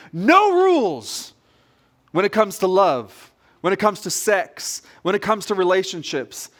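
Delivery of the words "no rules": slow, drawn-out call, higher and louder; mock shout. Preacher voicing the world's cry to the congregation, drawn-out shout. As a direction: chant it like the world's slogan. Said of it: "a world that's shouting".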